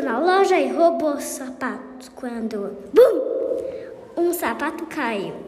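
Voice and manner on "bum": loud bang. Acted. Shoe falls in the dark.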